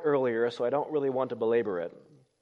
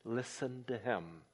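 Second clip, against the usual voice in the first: softer, listen to him almost breathed; quiet echo of the heavenly voice; hushed voice of God; 4 semitones lower, 12 dB quieter than usual. Shown as a demonstration. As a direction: let it fall away to a hush. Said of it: "This is my beloved Son".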